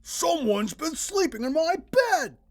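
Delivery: father hollering at the messed-up bed; hollered. A narrator's reading. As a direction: holler it across the room. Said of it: "Mister Chon hollered".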